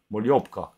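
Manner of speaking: Moliopka said slowly, every syllable clear. Shown as a demonstration. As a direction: say Moliopka carefully. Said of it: "Dis-le".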